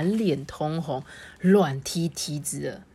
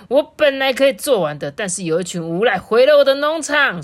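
loud, higher-pitched complaint; storybook farmer venting at troublemakers; exasperated anger; 6 semitones higher, 8 dB louder than usual. A narrator's reading. Farmer to friends who ruined his farm, loud exasperation. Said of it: "已经气到没有力气了".

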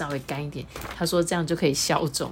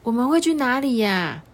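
a bit worried; 7 semitones higher than usual. Acted. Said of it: "有点担心说".

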